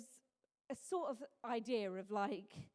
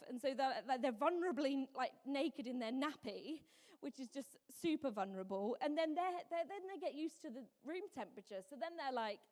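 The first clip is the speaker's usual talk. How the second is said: ordinary talk, nothing marked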